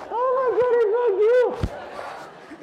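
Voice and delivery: loud, stretched falsetto squeal; gushing puppy owner. Acted. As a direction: squeal at a puppy, wildly overdone. New owner gushing over puppy.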